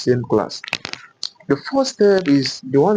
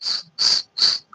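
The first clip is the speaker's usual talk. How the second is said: a hushed hissing s; whispered s. Shown as a demonstration. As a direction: let the s out as a bare whisper. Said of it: "you are going to whisper".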